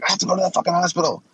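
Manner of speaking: high, fast mock demand; imitating Tammy. Mocking Tammy's demand to the friends, high and fast. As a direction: squeal it out fast, mocking her. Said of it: "She's like".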